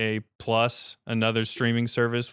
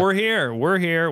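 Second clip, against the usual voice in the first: higher, louder rallying cry; loud proclamation; 8 semitones higher, 6 dB louder, slower than usual. Real, not acted. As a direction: proclaim it to the crowd. Defiant declaration to audience.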